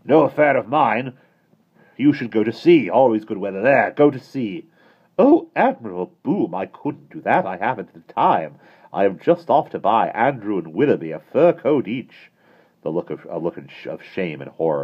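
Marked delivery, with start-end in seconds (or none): 0.0-4.7 loud gruff sailor; blustering admiral. A narrator's reading. Admiral Boom speaking. 5.2-12.3 fussy lady. A narrator's reading. Miss Lark speaking.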